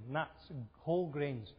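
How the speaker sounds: ordinary delivery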